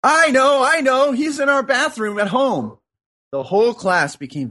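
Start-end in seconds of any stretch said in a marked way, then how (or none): 0.0-2.8 blurted out. Acted. Pupil waving his hand finally gets to answer, excited high blurt. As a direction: burst out with it, pitched way up. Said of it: "finally blurted".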